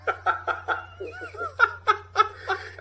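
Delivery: high cackling laugh; burst of laughter. Real, not acted. Narrator laughing at his own bit, high pitched. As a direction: crack up at his own rap.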